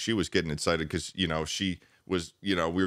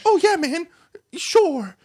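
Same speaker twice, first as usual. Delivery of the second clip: high, loud cartoon-mouse squeak; Mickey Mouse voice; 7 dB louder, slower than usual. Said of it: "He doesn't talk like Mickey Mouse".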